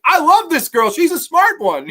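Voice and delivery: high, loud, hammed-up glee; mock Val Kilmer delight. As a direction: crow with delight at her joke. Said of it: "he's like".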